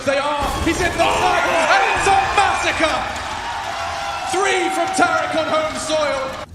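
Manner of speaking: very high, loud screaming call; hyped shout. Real, not acted. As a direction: scream it like a huge play. Triple kill on home soil.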